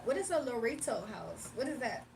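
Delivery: ordinary talk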